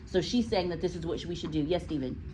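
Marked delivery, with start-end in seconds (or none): none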